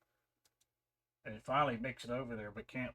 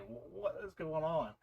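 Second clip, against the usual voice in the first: baffled; 6 semitones higher than usual. Retold or imitated. Acts out own baffled reaction.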